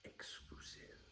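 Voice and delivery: breathy mock-dramatic whisper; spooky secret whisper. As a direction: hush it like a secret. Whispered punchline.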